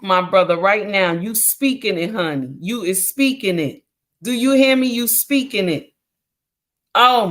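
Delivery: ordinary talk, nothing marked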